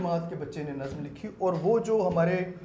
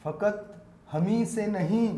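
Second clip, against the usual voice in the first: naat recitation; 5 semitones higher, 4 dB louder than usual. Acted. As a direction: recite each word with weight. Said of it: "نعت کے چند شعر پیش کرنا چاہوں گا".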